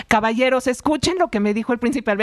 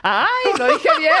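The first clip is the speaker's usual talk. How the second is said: laughing; 10 semitones higher, 5 dB louder than usual. Real, not acted. Laughs after her French greeting.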